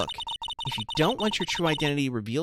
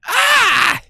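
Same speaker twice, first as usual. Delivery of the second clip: much higher and louder overexcited buddy; loud excitable goofball; 7 dB louder than usual. Acted. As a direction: yell it like a thrilled old friend. Larry excited to see Nick.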